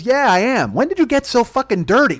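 loud, high-pitched mom rant; mock scolding mother. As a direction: imitate an outraged mother telling off her son. Mother scolding son, acted.

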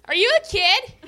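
high-pitched imitation of a four-year-old; little girl. Four-year-old asking her babysitter, imitated little-girl voice. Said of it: "this four-year-old little girl".

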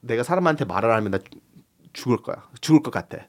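the mom's line said in Korean, quick; 내가 사람한테 말을 하면 나 죽을 거야 spoken fast in Korean; 한국어로 빠르게. Shown as a demonstration. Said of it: "How do you say that in Korean?".

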